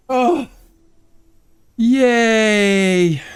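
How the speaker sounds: high, delighted yay; little cheer. Real, not acted. Streamer celebrating his found airship, high little cheer.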